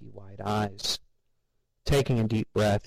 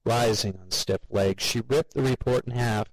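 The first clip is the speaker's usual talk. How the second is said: plain reading voice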